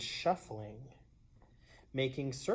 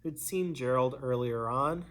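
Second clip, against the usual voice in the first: usual speaking voice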